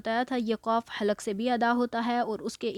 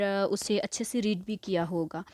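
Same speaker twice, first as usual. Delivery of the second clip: ordinary delivery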